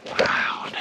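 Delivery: breathy whisper of awe. Real, not acted. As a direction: whisper it in amazement. Whispered echo.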